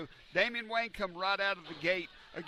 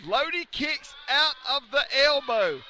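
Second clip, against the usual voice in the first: shocked shout; 7 semitones higher, 8 dB louder than usual. Real, not acted. Disbelief at kick-out.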